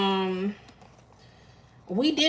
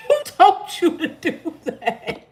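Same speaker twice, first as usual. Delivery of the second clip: higher, laughing as she repeats it; 8 semitones higher, 5 dB louder, slower than usual. Real, not acted. Teasing a viewer about his phone call, laughing. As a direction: crack up while asking again. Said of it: "I'm laughing at Chris".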